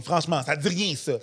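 ordinary talk

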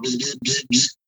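soft mouth buzz like a faulty lamp; imitated buzzing light. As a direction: buzz quietly through the lips like a flickering beer light. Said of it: "flashing off and on".

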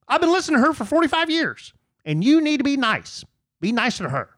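high, fast whiny fan voice; mocking listener voice. Mocking a listener's message in a high whiny voice. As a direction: squeak it out like a nagging fan. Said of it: "people say something like".

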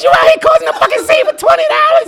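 high, loud mock disbelief; retelling his stunned reply to her; incredulous outburst. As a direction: yell it back in disbelief. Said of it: "I said".

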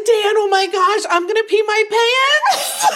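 very high, laughing squeal; ecstatic squeal. Retold or imitated. She tells Dan her reaction to being hired, ecstatic squeal. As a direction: squeal with overjoyed disbelief, stretching the end. Reenacts hearing she got the part.

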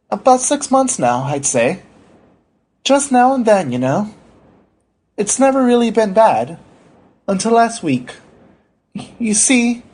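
high-pitched, meek patient voice; hesitant patient. Acted. Story figure: anxious patient Wheatley.

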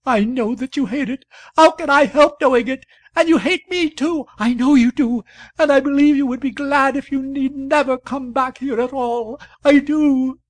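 rising, high and loud tearful rant; hysterical accusation. A narrator's reading. Wife accusing her husband of hating her, hysterical shout. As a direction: pile the accusations up in a frenzy.

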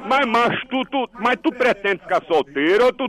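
ordinary talk

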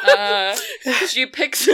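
high, slowed words through laughter; laughing. Real, not acted. Game master joking with players mid-scene, laughing. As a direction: crack up while getting the words out.

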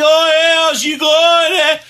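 mock a North Bay girl in a broad Canadian accent; exaggerated Canadian accent. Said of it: "she's like".

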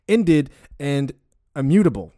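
usual speaking voice

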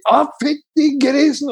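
high, drawn-out shriek of disgust; hypothetical panicked outburst, acted out; mock scream. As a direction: shriek it slowly in mock horror. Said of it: "Sånn som hadde jeg skriket".

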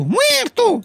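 very high, drawn-out echo; second assassin's echo. A narrator's reading. Second assassin echoes his partner's death threat, high-pitched. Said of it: "disse l'altro".

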